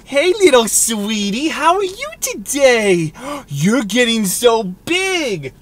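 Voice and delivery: put-on slow, high baby-talk coo with a gasp. Husband baby-talking his wife, slow and high. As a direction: coo at a grown partner like at an infant. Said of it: "talk to your spouse ... like a legitimate baby".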